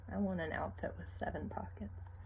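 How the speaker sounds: plain reading voice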